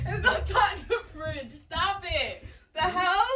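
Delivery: loud, high, laughing call; laughing shout. Real, not acted. Shouting to partner where his phone is, laughing.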